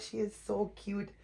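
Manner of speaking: normal talking voice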